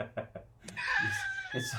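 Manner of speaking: breaks into a high laughing yelp. Real, not acted. Mocking the news media, real burst of laughter. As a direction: laugh out the word.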